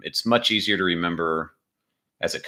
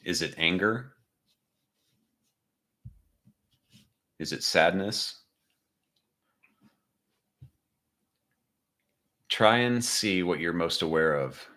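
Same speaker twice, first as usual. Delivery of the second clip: guided meditation. Acted.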